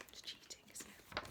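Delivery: hushed confiding whisper; whispered aside. Real, not acted. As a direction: lean in and whisper to the listener. Aside to listener.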